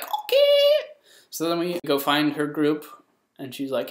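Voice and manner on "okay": very high, loud squeal; hammed-up falsetto yelp; loud girl. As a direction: imitate an overexcited girl agreeing. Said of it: "this girl who was loud".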